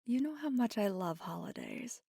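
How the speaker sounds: lively, lifted, laughing delivery; playful amusement. Acted. Telling a partner about loved holidays, playful amusement. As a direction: tease fondly, with laughs breaking in.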